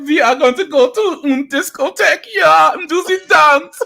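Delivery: high, loud mock German accent; thick German accent. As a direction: ham up a German accent excitedly. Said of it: "The thick German accents".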